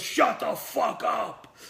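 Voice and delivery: slow, high angry outburst; Burt Reynolds chewing out. Retold or imitated. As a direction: cut him off, drawing out each word. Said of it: "he starts chewing me out".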